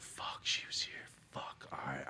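whispered, unvoiced curse; dad's inner thought. Dad realising she came for him, whispered. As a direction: breathe it like a guilty realisation. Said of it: "you just went".

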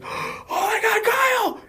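very high, drawn-out squeal of alarm; mock horrified cry. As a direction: act out shock in a squeaky falsetto. Acting out shock at injured friend.

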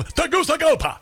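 reporter's sudden showy Spanish pronunciation; mock Spanish accent. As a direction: jump into overdone Spanish for the place name. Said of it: "switch into Spanish mode".

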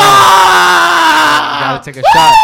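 high, loud yelling call; shouted penalty. Real, not acted. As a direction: bellow it out across the room. Host calling out the penalty.

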